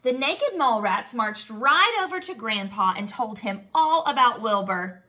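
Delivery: plain reading voice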